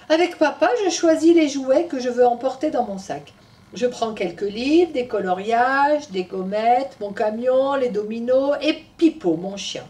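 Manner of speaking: put-on lively storytelling voice for children; story narration. A narrator's reading. Storyteller telling Tom's story to children, animated. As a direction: read it to little ones.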